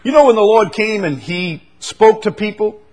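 high, loud exhortation; forceful preaching. Real, not acted. Preacher tells congregation the Lord brought the truth, forceful preaching. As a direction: drive the point home to the congregation.

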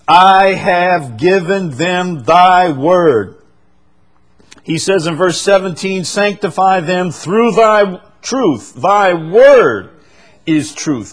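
loud, high, slow scripture proclamation; forceful preaching. Real, not acted. Preacher reads verse fourteen and seventeen to the congregation, forceful preaching. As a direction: ring out each verse slowly and loudly.

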